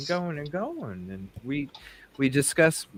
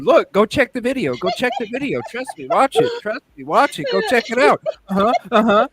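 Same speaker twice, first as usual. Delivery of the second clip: excited hype; 10 semitones higher, 7 dB louder, faster than usual. Pretend. Hyping the video, loud.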